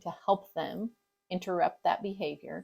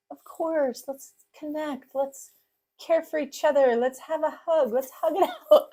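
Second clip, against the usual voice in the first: mock sugary parent; 8 semitones higher, 5 dB louder than usual. Mocking blissful perfect parent.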